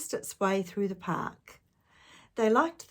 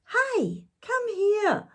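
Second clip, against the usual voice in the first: high, loud call to friends; 5 dB louder, much slower than usual. A narrator's reading. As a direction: call them over from the riverbank. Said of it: "he called to Annie and Tom".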